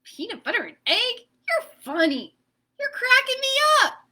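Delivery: amused giggly egg. Acted. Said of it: "Egg laughed".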